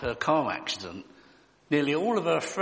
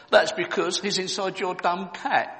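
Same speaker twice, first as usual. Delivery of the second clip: put-on little girl; 6 semitones higher, 3 dB louder than usual. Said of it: "she replied".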